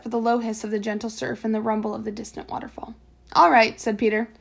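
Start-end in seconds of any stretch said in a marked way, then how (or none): 3.3-3.8 loud reply. A narrator's reading. Peter agrees, shouting across.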